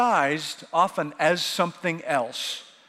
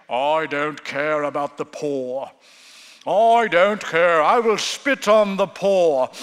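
put-on slow miserly Scrooge accent, the last lines much louder; 2 semitones lower, 5 dB louder, slower than usual. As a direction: sneer it out like old Scrooge in a heavy accent. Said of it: "I don't have an accent like that".

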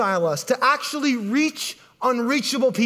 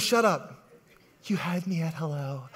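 mock tender whisper; 7 semitones lower, 6 dB quieter than usual. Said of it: "Renee Zellweger goes".

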